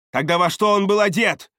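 loud, high snapped question; barked demand. A narrator's reading. Чара demands the client's description, loud bark. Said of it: "рявкнула она".

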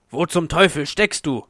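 loud, irritated shout; angry call. A narrator's reading. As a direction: call out angrily for someone missing. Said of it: "rief er wütend". (wütend rufen)

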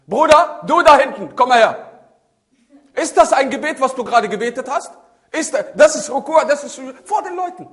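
loud, high, hammed-up reprimand; public scolding. Imam to a worshipper before everyone, loud acted scolding. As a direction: call him out across the room.

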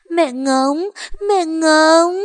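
put-on high, loud tearful child; crying little girl. A narrator's reading. Monkey cries for her mother, loud, high and tearful. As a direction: cry out for mother through sobs.

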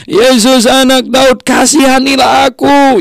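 high, slow pleading call; a blind man's desperate high cry for mercy; crying out to Jesus. Acted. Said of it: "Dia berteriak sekencang-kencangnya".

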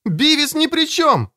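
very high, desperate protest; urgent high-pitched plea; crying out. A narrator's reading. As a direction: shout in a friend's defence. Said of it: "крикнула Кэтрин".

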